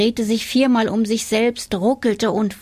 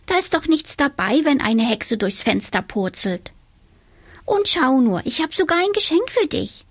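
high, quick, eager witch voice; little witch coaxing; 6 semitones higher than usual. A narrator's reading. Little witch offering the boy a gift, eager. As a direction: win him over with a gift.

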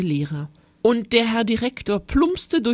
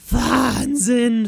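drawn-out, raised exclamation; hissed amazement; 4 semitones higher, 4 dB louder than usual. A narrator's reading. Cheeky Fred gapes at the monster, hissed exclamation. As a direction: hiss it out, stretching the word. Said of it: "fauchte der freche Fred".

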